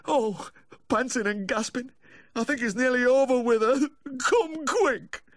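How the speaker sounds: very high, tearful wail; sobbing plea. A narrator's reading. Owner describing his gasping dog to the vet, sobbing. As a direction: cry it out high, begging for help. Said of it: "pleading between sobs".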